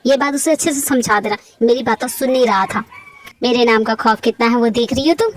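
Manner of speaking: a mafia king warning his sister; menacing mafia boss. Acted. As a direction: lean in like a gangster.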